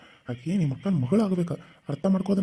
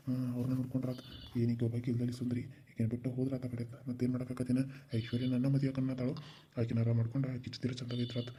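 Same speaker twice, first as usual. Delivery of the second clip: low, much softer muttered plotting to himself; hushed aside; 4 semitones lower, 12 dB quieter than usual. Acted. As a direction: say it under your breath so no one hears. Scheming aside after the threat.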